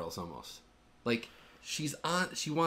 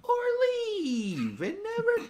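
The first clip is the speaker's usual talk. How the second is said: slow, very high put-on whine; mock-aggressive dismissal; 12 semitones higher, much slower than usual. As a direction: drag it out in a squeaky pretend huff. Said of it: "Why, why so aggressive?".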